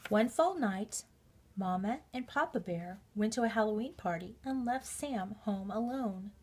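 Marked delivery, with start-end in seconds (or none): none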